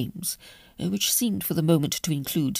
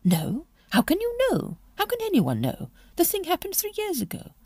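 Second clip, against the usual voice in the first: much higher disbelieving lady voice; incredulous duchess; 14 semitones higher than usual. A narrator's reading. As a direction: refuse to believe it, rising high.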